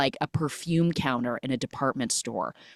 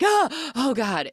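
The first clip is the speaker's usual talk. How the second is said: startled; 9 semitones higher, slower than usual. Retold or imitated. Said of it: "she's like".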